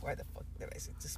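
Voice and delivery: fast panicked whisper to himself; whispered to self. Retold or imitated. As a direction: hiss it under your breath, quick and regretful. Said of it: "I started telling myself".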